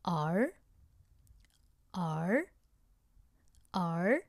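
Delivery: a lone curled-tongue r held on its own; drawn-out r sound. Shown as a demonstration. Teacher models the r sound for students, isolated. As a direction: say the retroflex r slowly for learners to copy. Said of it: "make this sound after me".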